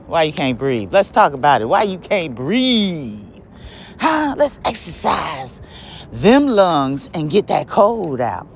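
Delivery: ordinary talk, nothing marked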